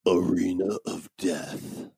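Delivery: slow, quiet, dramatic countdown voice; listicle countdown. Pretend. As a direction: announce it like a top-ten reveal. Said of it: "with that countdown voice".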